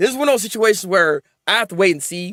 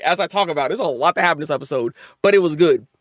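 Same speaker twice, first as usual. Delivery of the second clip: ordinary delivery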